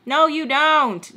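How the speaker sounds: slow, emphatic denial; firm. Retold or imitated. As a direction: shut him down slowly.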